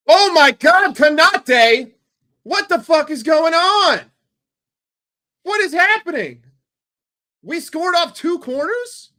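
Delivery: high, loud shouts of disbelief; stunned goal celebration. Real, not acted. Fan reacting to Konate's goal, ecstatic disbelieving shouting. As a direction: scream it as the ball goes in.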